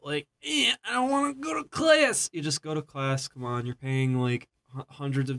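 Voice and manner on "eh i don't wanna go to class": high-pitched mock tantrum whine; throwing a fit. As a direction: whine like a child refusing, hammed-up. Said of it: "instead of throwing a fit".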